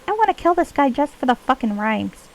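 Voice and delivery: high-pitched pixie aside. Acted. Player griping about the wisp's rhymes, high pixie voice. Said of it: "pixie voice when I'm not in character".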